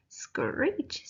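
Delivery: high, breathy possum screech; high whispered animal screech. Acted. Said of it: "screech went the possum".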